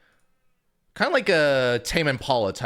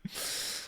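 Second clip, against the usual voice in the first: soft unvoiced chuckle; a quiet breath of a laugh; real rueful laugh; breathy silent laugh; 14 dB quieter than usual. Real, not acted. Laughs at himself.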